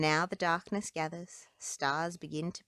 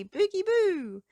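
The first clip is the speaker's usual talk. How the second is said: playful call; 12 semitones higher, 7 dB louder than usual. Acted. Trolls' magic phrase.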